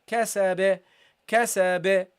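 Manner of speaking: "kasabe" with a bounced final b; teacher's demonstration of a bounced ending; qalqalah bounce. Shown as a demonstration. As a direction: release the b at the end of "kasabe" with a small echo. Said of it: "You have to give it a bounce".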